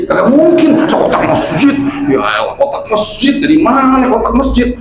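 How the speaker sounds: high, exclaiming disbelief, hammed-up; mock incredulity. Acting out doubters questioning the ustaz's money source, mock incredulous. Acted disbelief over mosque donation box.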